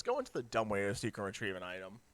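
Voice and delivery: ordinary talk, nothing marked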